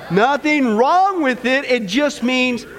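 slow, stretched prompt; drawn-out catchphrase. Shown as a demonstration. Pastor making the congregation try again, slow drawn-out prompt. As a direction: draw the words out so everyone joins.

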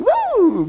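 high mock squeal like a pig; a jokey high pig squeal. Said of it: "sounds of pigs squealing".

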